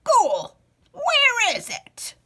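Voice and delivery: put-on old man hollering at the ghoul; hollered. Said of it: "hollered out".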